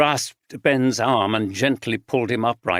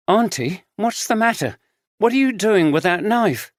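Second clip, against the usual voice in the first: frightened; 9 semitones higher than usual. A narrator's reading. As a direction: plead in fear at the knife. Frightened girl facing a knife.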